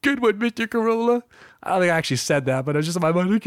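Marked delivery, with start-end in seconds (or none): 0.0-1.2 high, goofy starstruck voice; stupid fanboy. Retold or imitated. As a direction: play the awkward fan. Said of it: "me, all stupid".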